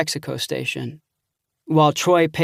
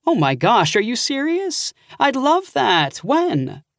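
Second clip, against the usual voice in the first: much higher, gushing with delight; delighted aunt; 9 semitones higher, slower than usual. Acted. Aunt hearing her nephew will visit, thrilled and higher. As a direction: she can't believe he's coming, thrilled.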